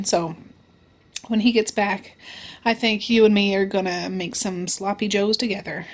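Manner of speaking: ordinary talk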